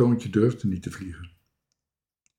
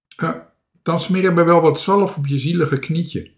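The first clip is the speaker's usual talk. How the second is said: higher, raised, sugary sweet; sweet little voice; 7 semitones higher, 5 dB louder than usual. A narrator's reading. Marinka calls up to Toontje, sweet little voice. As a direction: coo it loudly at a pitiful little one. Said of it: "riep Marinka met een lief stemmetje".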